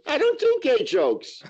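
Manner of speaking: drawn-out sarcastic retort; mock retort.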